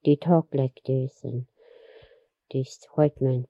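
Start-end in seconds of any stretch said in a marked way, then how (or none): none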